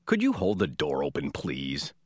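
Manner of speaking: low, curt-toned demand; impolite request. Shown as a demonstration. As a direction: ask rudely, pitch dropped and please falling away.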